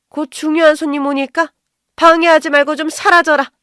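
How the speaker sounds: loud, high-pitched angry tirade; irate mother-in-law shouting down an intruder; furious scolding. Acted. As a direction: yell at them to get out of your way. Mother-in-law driving out daughter-in-law.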